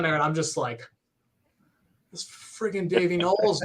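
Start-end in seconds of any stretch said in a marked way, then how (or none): 2.1-3.6 stunned, held-back fan excitement; awestruck, the excitement still kept in; freaking out. Retold or imitated. Fan spotting his idol at the merch booth, freaking out inside. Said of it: "I'm freaking out, right?".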